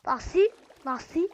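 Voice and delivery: loud high startled question; surprised. Real, not acted. Seeing something strange, asking in surprise. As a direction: blurt it out in surprise.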